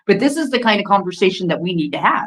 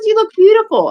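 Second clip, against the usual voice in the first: high gushing compliment; 13 semitones higher, 4 dB louder than usual. Retold or imitated. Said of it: "And I said".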